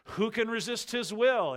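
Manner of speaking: loud, high accusing challenge; blaming. Acted. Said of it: "As if blaming God here".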